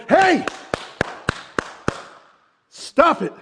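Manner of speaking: high, loud "Hey, stop it", then a slow firm "Turn your focus"; firm call to stop. Retold or imitated. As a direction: cut him off and turn him around. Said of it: "especially when it's Jesus going".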